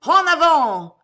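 high, loud, roared answer; thunderous battle cry. A narrator's reading. Three hundred voices answering Cato, thunderous roar. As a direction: roar it back like a whole crowd. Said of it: "répondit le tonnerre des trois cents voix".

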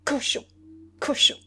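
high, loud, each word spat out; woman hurling insults at her lover. A narrator's reading. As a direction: spit the word like spitting on him. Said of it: "répétait".

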